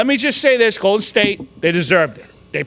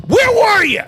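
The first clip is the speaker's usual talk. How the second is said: high, indignant cry; pissed off; 7 semitones higher, 3 dB louder than usual. Real, not acted. Fan demands to know where the star was, pissed off. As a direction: call out the absentee in outrage. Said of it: "I'm fucking pissed".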